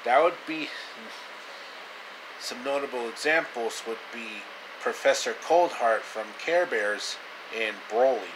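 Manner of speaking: plain reading voice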